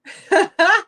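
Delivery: high giddy burst of laughter; delighted laugh. Real, not acted. Seller laughs loud and high at her friend showing up.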